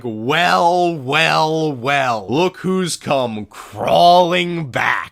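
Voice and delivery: slow, drawn-out smug gloat; slow mock-villain gloat; smug gloating queen. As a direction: savour every word like a gloating villain. Bohrok queens gloating at Toa.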